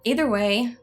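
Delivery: normal talking voice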